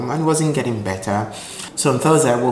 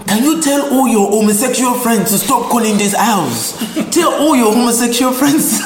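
loud, high-pitched scolding aunt impression; acted-out angry aunt yelling, breaking into laughter; Nigerian aunt; 11 semitones higher, 6 dB louder than usual. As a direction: shout it like an exasperated Nigerian auntie, hammed up. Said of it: "you've seen a Nigerian aunt before".

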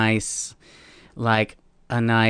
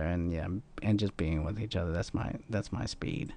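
quiet; 3 semitones lower, 11 dB quieter than usual. Real, not acted. Trailing off softly.

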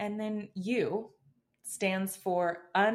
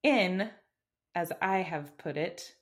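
normal talking voice

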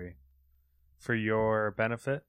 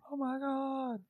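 surprised; 5 dB quieter, slower than usual. Real, not acted. Reacts to Burning Crusade news.

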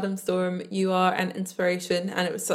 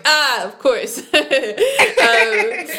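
laughing realisation; 8 semitones higher, 10 dB louder, much slower than usual. Retold or imitated. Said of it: "I was like".

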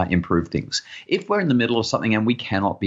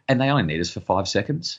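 ordinary talk, nothing marked

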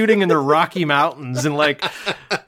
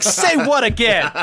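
high, slow mock-menacing challenge; hammed-up tough-guy movie threat; Samuel L. Jackson; 9 semitones higher, 4 dB louder, slower than usual. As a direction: dare them, drawing the words out. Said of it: "Like he's Samuel L. Jackson being like".

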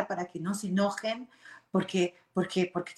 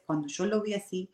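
ordinary delivery

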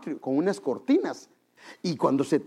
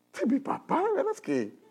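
mocking child voice; 14 semitones higher than usual. Children mocking father's change.

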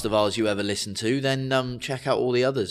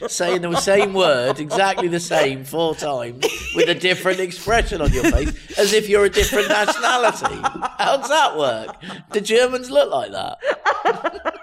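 higher, louder, laughing disbelief; laughing incredulity; 6 semitones higher, 4 dB louder than usual. Real, not acted. Host ribbing co-host's identical accents, incredulous laughter. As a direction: tease them in helpless disbelief.

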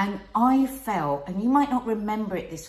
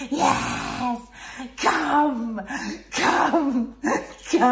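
louder, breathy moans of come, half laughing; mock labour moaning; 4 semitones higher, 4 dB louder, much slower than usual. Wife retelling labour to husband, mock moaning. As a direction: reenact pushing through a contraction, laughing. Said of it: "and I would be going".

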